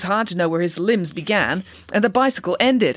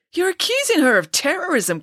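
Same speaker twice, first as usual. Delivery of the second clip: high-pitched, disbelieving outburst; incredulous cry; 12 semitones higher than usual. A narrator's reading. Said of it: "cried Ian, incredulously".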